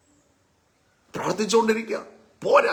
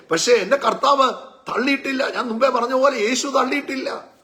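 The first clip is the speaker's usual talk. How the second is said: raised, higher preaching voice, driving each line; fervent preaching; 4 semitones higher than usual. Acted. Preacher urging the church not to give up, fervently. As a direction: preach it with rising fervour.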